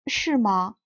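usual speaking voice

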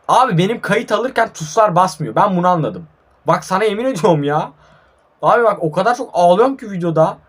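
high, loud gamer rant; exasperated complaint. Real, not acted. Gamer complains buttons fail while recording, loud exasperation.

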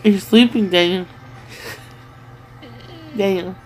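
ordinary talk

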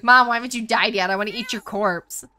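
cat voice, suddenly loud and greedy; mock-loud cat demanding a corpse. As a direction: shout it like a hungry cat begging. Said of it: "She's like".

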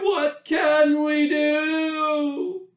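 slow, high, drawn-out cry of alarm; villagers crying out. A narrator's reading. Villagers crying out to each other, slow high worried cry. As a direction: wail it long like a worried crowd. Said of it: "the villagers cried".

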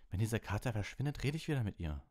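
high, heated, indignant voice; angry retort. A narrator's reading. Ron refusing to talk to Hermine over the cat, angrily. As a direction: snap back crossly, pitch climbing. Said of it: "sagte Ron zornig".

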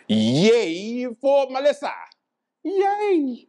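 high, drawn-out yay; mock cheer. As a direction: cheer in a long, high squeal.

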